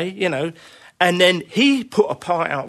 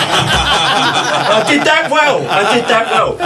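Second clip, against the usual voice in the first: loud, high, slow through laughter; laughing self-mockery; 4 semitones higher, 6 dB louder, slower than usual. Drummer joking about his failed reading test, laughing self-mockery. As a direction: crow it sarcastically while laughing.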